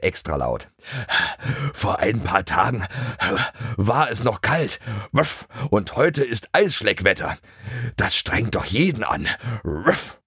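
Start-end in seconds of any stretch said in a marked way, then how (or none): 1.7-10.2 a hint of a whiny dog with barks; lightly voiced dog. A narrator's reading. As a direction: lightly grumble like a panting dog. Dog friend Bruno complains. (leicht angedeuteter jammernder Hund)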